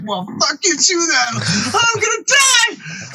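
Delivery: slow, very high, hammed-up despairing cry; panicked wail. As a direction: wail it in mock despair, voice shooting up. Teller acts out his despairing outburst.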